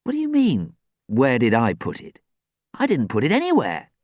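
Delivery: high, puzzled protest; bemused. A narrator's reading. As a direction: answer in baffled disbelief. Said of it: "he replied, bemused".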